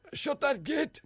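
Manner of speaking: high mock shout; impersonated yell; Gregory Campbell. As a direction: call it out high and hammed-up.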